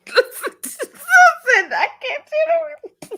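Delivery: high, squeaky words through laughter; laughing too hard to talk. Real, not acted. Streamer reacting to what just came in, helpless laughter.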